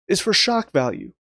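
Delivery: ordinary talk, nothing marked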